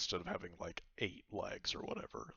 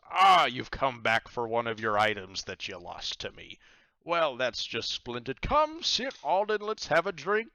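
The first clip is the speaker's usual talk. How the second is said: loud, much higher jovial pirate; delighted pirate captain; 5 semitones higher, 11 dB louder than usual. Acted. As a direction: welcome them with a big hearty laugh in the voice. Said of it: "and he says".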